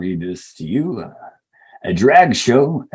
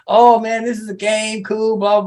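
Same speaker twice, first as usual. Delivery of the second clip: mock gullible excitement; 9 semitones higher than usual. Mocks people entering the Matrix.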